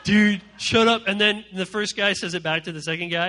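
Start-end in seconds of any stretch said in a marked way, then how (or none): 0.0-1.0 mock dude voice. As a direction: play a teasing guy brushing it off. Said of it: "they're both like".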